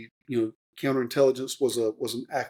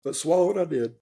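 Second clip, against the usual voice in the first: real tears held back, higher pitched; forcing words out through real emotion; choked up; 6 semitones higher than usual. Real, not acted. Veteran to the host about leaving the Army, choking up. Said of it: "I'm gonna get emotional".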